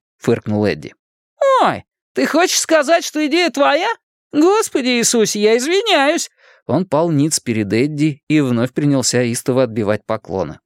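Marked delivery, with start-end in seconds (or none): 1.6-6.3 very high, exaggerated boyish apology; hammed-up sarcastic sorry; wisecracking boy, mock-apologetic. A narrator's reading. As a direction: grovel theatrically in a high voice. Narrator plays Richie mock-apologising.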